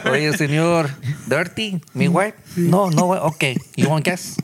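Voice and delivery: high, hammed-up Mexican accent; mock Mexican accent. Mock Mexican accent as a windshield washer offering gas. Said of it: "That's an accent".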